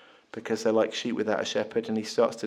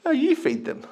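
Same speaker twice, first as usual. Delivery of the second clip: high, teasing cheeky Jesus. Acted. Jesus cheekily turning the task back on his disciples. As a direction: toss it back at them with a cheeky lilt. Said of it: "Jesus says cheekily".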